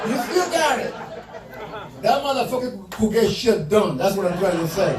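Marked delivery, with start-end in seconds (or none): 0.1-0.9 high put-on boxer voice; Tyson impression again. Comic repeats the boxer's line in his high voice. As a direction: echo the champ, high. Imitating Mike Tyson after video.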